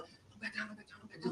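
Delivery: soft, urgent, under the breath; silently urging him to sit down; inner voice. Retold or imitated. Defendant silently urging his attorney, soft and tense. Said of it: "in my head, I'm going".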